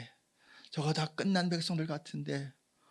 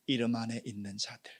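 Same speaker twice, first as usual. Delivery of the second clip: normal talking voice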